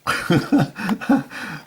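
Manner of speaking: a real burst of high laughter. Real, not acted. Amused by the long snow story, bursting into high laughter.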